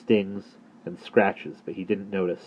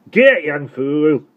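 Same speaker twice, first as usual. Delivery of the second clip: put-on loud, high Dorset accent, scolding; strong Dorset accent; 8 semitones higher, 8 dB louder, much slower than usual. Postman scolds the boy after the near crash, loud Dorset accent. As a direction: rant at the boy in thick Dorset. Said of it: "he said again".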